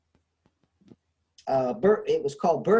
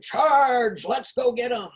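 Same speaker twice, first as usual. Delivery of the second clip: lightly acted rallying call, higher pitched; higher-pitched charge cry, only half shouted; mock battle cry; 8 semitones higher, 3 dB louder than usual. Lecturer imitating charging horsemen in an old film, mock battle cry.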